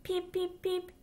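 high squeaky little duckling peeps; baby duck peeping. Acted. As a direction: chirp it out high like a tiny duck. Baby duck's peeps in story.

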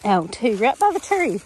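ordinary talk, nothing marked

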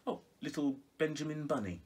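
ordinary delivery

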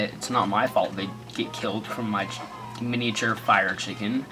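normal talking voice

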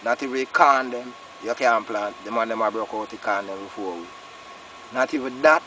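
ordinary talk

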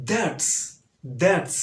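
modelling "That's". Shown as a demonstration. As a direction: say "That's" with a sharp s. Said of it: "দন্তুস্ব হবে that এর সাথে".

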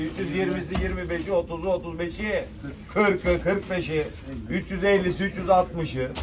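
loud auction call; auctioneer. Real, not acted. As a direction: call the lot to the room. Calling rising bids.

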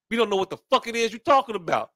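ordinary delivery

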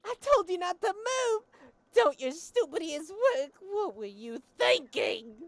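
loud, high, furious rant; furious scolding. Acted. A woman berating the one who crushed her lens, furious. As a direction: tear into the clumsy culprit.

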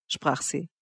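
usual speaking voice

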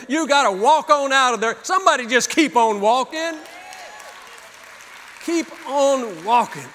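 pounding sermon refrain, ending in a shout; preaching at full force. Acted. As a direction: build it up like a pulpit climax. Donkey story builds into preaching.

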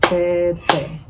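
verde broken into ver, de; syllable by syllable. Shown as a demonstration. Teacher demonstrating the count, verde syllable by syllable. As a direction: beat out verde as two separate pieces. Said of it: "clap out the syllables".

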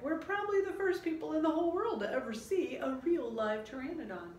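put-on high, loud excited boy; high, loud boyish pride; eager Jack. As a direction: boast proudly as a young scientist.

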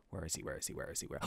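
very soft, low muttering; barely audible, anxious repetition; hushed searching. Acted. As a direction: mutter it to yourself while searching. Searching under his breath for captive.

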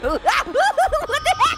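high, laughing and startled. Real, not acted. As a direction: laugh it out in shock. Spooked by the glitchy ghost.

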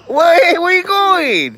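high, slow call after her. Real, not acted. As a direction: call after someone leaving. Calling after someone leaving.

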